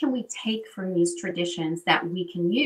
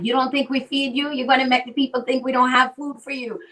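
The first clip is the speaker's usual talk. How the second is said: higher, louder mock scolding; imitating indignant grandfather; 5 semitones higher, 5 dB louder, faster than usual. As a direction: scold like an offended grandfather, retold. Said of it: "He's like".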